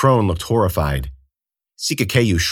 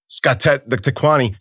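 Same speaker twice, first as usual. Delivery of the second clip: plain reading voice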